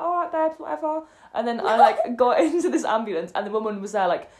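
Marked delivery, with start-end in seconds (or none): 0.0-1.0 imitated clubbing girls. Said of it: "And they were like".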